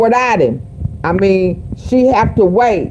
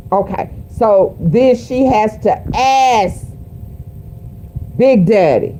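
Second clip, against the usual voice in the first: usual speaking voice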